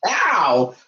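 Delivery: usual speaking voice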